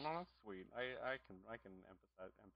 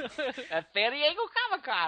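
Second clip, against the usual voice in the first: put-on slow, high-pitched child; little kid impression; 10 semitones higher, slower than usual. Comic adding Comic-Con as the child, high slow kid voice. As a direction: stretch it out in a small boy's voice.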